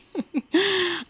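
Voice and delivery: high, bright burst of giggling; a quick real giggle at her own joke. Real, not acted. Vlogger giggling at the game, real laugh.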